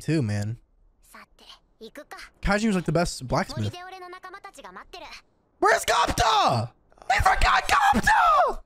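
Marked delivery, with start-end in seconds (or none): 5.6-8.6 stretched, rising hype shout; excited shout. Real, not acted. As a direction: yell like you just remembered. Excited shout about a character.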